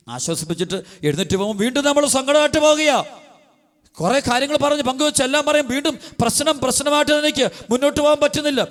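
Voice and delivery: high, impassioned sermon voice; fervent preaching. Acted. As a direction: preach it out with rising fervour. Preacher rises to high pitch. (ഉയർന്ന സ്വരത്തിൽ ആവേശത്തോടെ പ്രസംഗം)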